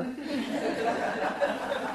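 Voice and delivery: a quiet, breathy laugh at his own joke; soft chuckle. Real, not acted. Joke about the Tabernacle Choir.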